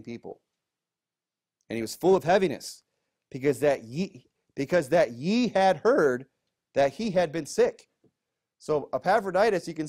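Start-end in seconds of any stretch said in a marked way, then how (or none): 1.7-7.9 raised, louder Bible-reading voice; scripture reading. Acted. Pastor reading scripture to the congregation, raised voice. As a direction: read the verse out with weight to the room. Reads verse twenty-six.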